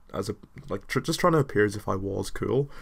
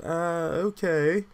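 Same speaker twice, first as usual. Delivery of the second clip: hesitant; 8 semitones higher, much slower than usual. Retold or imitated. Hesitant reply to drunk girl.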